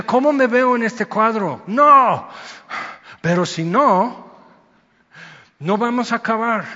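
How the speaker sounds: retold anger rising to a loud, high cry; angry outburst. Preacher recalling his anger at the wrestling coach, loud. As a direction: relive the fury of the match, building up. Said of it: "me enojé".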